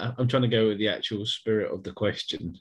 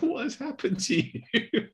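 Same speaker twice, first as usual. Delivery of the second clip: high, slow, laughing through words; incredulous giggle at a glitch; laughing disbelief; slower than usual. Retold or imitated. Retold reaction to glitched friend.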